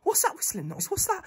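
high, soft, wheezy questions; gasping for breath. Acted. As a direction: ask it with barely any puff left. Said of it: "It's me, gasping for breath".

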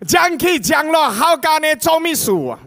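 high, loud scolding in Hokkien; Jesus telling the mourners off, loud and high; loud rebuke. Acted. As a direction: scold the noisy crowd sharply.

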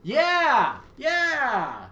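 loud, high, thrilled yeah; excited shouts. Real, not acted. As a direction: cheer it out at the top of your voice.

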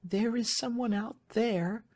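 high, pleading voice; Marian, agitated young lady. Acted. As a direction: appeal anxiously to a trusted servant, high-pitched.

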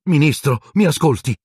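high, urgent plea for attention; urgent exclamation. A narrator's reading. Harry begging the minister to listen, urgent exclamation. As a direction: exclaim it, pitched well up. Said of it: "esclamò Harry".

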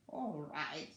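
low, weary sigh of agreement; piglet's glum consent; resigned sigh. A narrator's reading. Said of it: "sighed the piglet".

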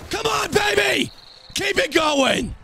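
high, slow, shouted cheer; will the play home at the top of your voice; excited shout. Real, not acted. Cheering his runner on.

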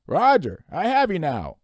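loud, high radio operator; distant radio voice. Acted. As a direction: call back over a poor radio link. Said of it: "The voice was very faint and breaking up".

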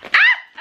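sudden high shriek; startled shriek. Real, not acted. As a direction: yelp in surprise. Startled by sharp teeth remark.

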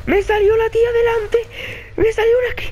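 high-pitched frightened squeal; panicked. Real, not acted. Player just ambushed by the creepy girl, panicked squeal. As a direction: blurt it in a high voice, real panic. Panicking after jump scare.

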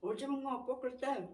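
chewing between words; talking through food; mouth partly full. Real, not acted. Seen 1: cheeks bulge, jaw working on food while speaking at table.

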